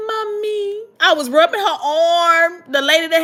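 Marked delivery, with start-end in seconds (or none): 0.1-0.8 high, plaintive little whimper; mock crying. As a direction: call out like a tearful child, acted. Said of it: "I was crying".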